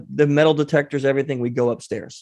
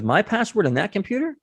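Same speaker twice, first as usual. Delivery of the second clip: higher, rising, mildly taken aback; imitated incredulous question; 7 semitones higher than usual. Woman asked for her password by a stranger, mildly incredulous. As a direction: imitate her doubt with a raised, questioning pitch.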